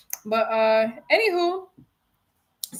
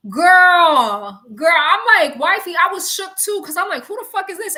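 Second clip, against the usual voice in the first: shook; 5 semitones higher, 7 dB louder than usual. Real, not acted. Shook reacting to wifey.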